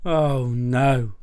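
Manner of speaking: loud groaned dismay; weary, loud protest; exasperated bear. Acted. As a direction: groan it loudly as a sleepless bear. Mister Bear kept awake by snoring.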